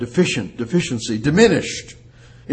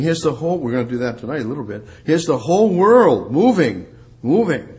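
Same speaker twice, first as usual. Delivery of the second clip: ordinary delivery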